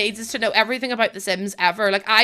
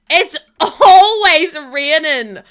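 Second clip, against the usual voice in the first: laughing; 6 semitones higher, 10 dB louder, much slower than usual. Real, not acted. Laughing complaint.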